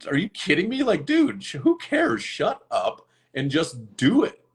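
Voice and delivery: rally yourself, incredulous and impatient; pumping himself up mid-breathwork, high and exasperated; exasperated pep talk. Retold or imitated. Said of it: "I'm like".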